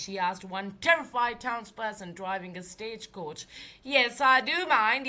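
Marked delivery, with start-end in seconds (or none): 3.8-5.1 louder, higher yell. Acted. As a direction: shout it back at her. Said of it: "yelled the stagecoach driver".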